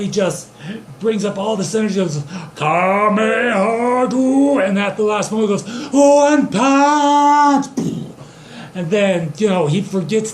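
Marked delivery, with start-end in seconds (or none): 2.6-4.1 long drawn-out battle cry; attack call. Acted. Joe powers up his energy beam, drawn-out attack call. 5.9-7.7 high, loud, sustained battle cry; attack shout. Acted. Joe switches attack at the last moment, huge shout.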